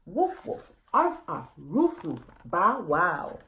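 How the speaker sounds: a light playful bark; lightly voiced dog barking; a hint of a dog barking. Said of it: "continued barking".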